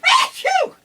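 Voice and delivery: loud high sneeze; a big real sneeze; sneezing. Real, not acted. Sneezing fit.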